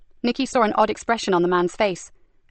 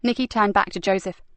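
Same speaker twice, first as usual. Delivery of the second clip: usual speaking voice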